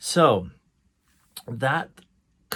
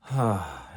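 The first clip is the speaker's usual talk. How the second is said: sigh into ah; 4 semitones lower than usual. Shown as a demonstration. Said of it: "it becomes an ah".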